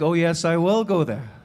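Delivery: ordinary delivery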